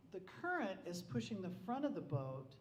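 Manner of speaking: usual speaking voice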